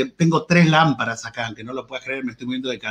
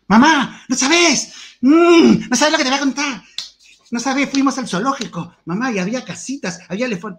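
put-on excited child; 9 semitones higher than usual. A child telling mom about the zoo, high and excited. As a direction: gush like a child back from the zoo. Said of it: "Escuchá a cualquier niño contando algo".

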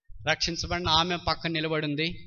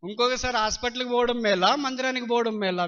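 higher and louder, పోవడం rising then falling; pointed choice; 4 semitones higher, 3 dB louder than usual. Retold or imitated. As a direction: set the two choices against each other. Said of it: "అట్లా మాట్లాడతావంటే". (ప్రశ్నగా గట్టిగా)

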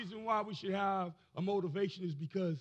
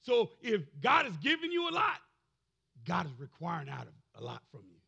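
normal talking voice

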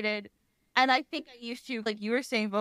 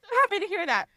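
laughing through the words, high; giggling with real delight; words broken by real laughter; 11 semitones higher, 4 dB quieter, faster than usual. Real, not acted. Laughing at dad's chill answer.

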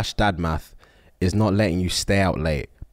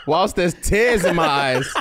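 laughing; 8 semitones higher than usual. Real, not acted.

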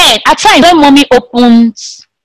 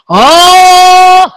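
long, higher "oh" held out; demonstrated shout; 6 semitones higher, 3 dB louder than usual. Shown as a demonstration. Teacher shows that shouting makes sound, shouting. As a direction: call out a sustained shout. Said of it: "When you are shouting".

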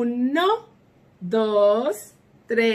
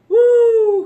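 loud high exclaim; excited uh; 12 semitones higher, 11 dB louder than usual. Real, not acted. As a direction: pop out a bright surprised sound. Excited lesson remark. (exclamación aguda y fuerte)

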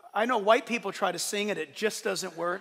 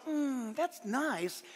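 slow, soft appreciative hum and reply; mock polite approval; 3 semitones higher, 8 dB quieter, much slower than usual. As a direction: imitate a placidly agreeing listener. Imagined listener reacting to verse.

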